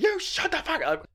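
high-pitched mock yell; retold screaming. Viewer telling off the debater on television, retold screaming. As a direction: imitate yelling at the TV without full volume. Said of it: "And I was screaming".

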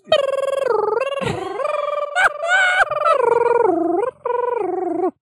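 long high-pitched laughing fit. Real, not acted. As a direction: break into helpless high laughter.

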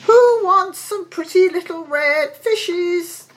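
high, loud, drawn-out hawking call; pedlar's street cry. A narrator's reading. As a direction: call your wares out slowly and high. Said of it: "he began to cry out".

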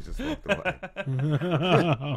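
slow, laughing through the line; laughing punchline. Real, not acted. Teller finishing the story for friends, laughing. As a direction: laugh out the ending.

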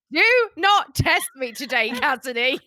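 jokey telling-off that cracks up at the end; mock threat. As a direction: play-act being pushed too far. Mock outburst at teasing co-hosts.

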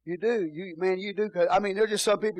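ordinary talk, nothing marked

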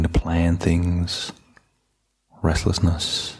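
low, even meditation voice with long pauses; calm, unhurried settling-in voice; guided meditation. Real, not acted. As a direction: gently guide listeners inward, leaving space between phrases.

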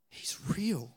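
breathy whispered aside. Real, not acted. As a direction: lean in and whisper it. Preacher drops to intimate whisper.

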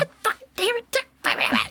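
high, slow exasperated curse; mock curse. Defeated king fleeing Alexander again, hammed-up exasperated curse. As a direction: whine it out as you run off.

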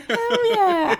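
loud, high, laughing "Hell yeah"; big gleeful catchphrase shout; Jenna Marbles catchphrase. Retold or imitated. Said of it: "like, Jenna Marbles".

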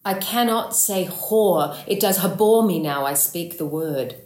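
dramatic verse with "abhor" punched; stress on abhor. Acted. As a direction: really give the word "abhor", long on its second syllable. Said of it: "really kind of give us that word".

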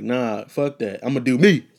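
cocky loud boast; defiant declaration. Retold or imitated. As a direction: shout it out proudly. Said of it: "I'm like".

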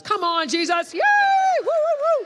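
high, loud whoop of praise; jubilant cheering. Real, not acted. Preacher celebrates healed cancer patients with the church, jubilant cheering. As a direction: cheer it out with abandon.

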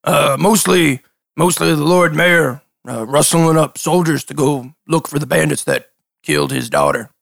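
lightly voiced dim fisherman; a hint of a cheerful simple NPC; Toko voice.

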